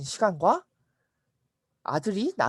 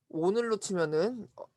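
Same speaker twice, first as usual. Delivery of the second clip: ordinary delivery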